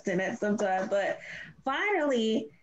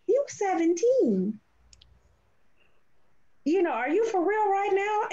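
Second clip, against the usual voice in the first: retold exasperation; 9 semitones higher than usual. Mother scolding teenage son, retold.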